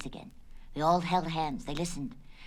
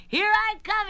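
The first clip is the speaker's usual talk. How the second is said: high, loud launch cry; excited yell; 8 dB louder than usual. Acted. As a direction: call it out mid-leap. Lenny leaps as a firecracker.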